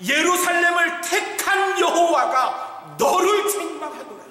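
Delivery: God thundering the rebuke at Satan; proclaimed rebuke. Retold or imitated. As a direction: proclaim it over the crowd. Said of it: "뭐라고 말씀하셨어요?".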